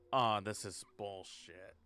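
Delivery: ordinary talk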